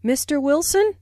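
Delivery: "Mister Wilson" climbing to a high end; rising intonation. Shown as a demonstration. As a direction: raise the pitch on "Wilson" to address someone. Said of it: "Notice the rising intonation on".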